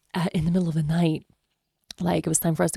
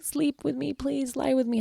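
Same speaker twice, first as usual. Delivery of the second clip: put-on higher, pleading little-girl voice; pleading toddler; 8 semitones higher, 4 dB quieter than usual. Mother voicing her daughter begging her to stay, higher. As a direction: beg softly like a small child.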